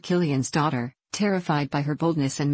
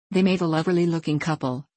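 plain reading voice

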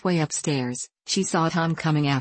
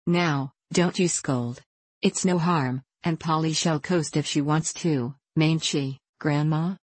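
usual speaking voice

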